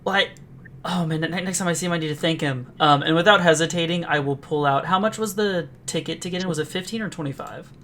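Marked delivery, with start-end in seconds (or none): 0.0-2.7 hushed, higher-pitched awe at first; quietly thrilled, settling as it goes; acted excitement. Young performer marvels at a surprise reward, quietly excited. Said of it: "I get very excited".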